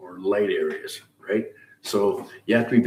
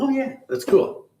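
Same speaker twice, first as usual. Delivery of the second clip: imitated approval. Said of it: "Try something else and go".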